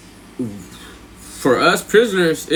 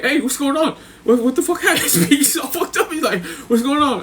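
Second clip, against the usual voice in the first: high, giggly imitation of bewilderment; mock confusion, laughing; 11 semitones higher than usual. V asking why the game stopped, laughing mock confusion.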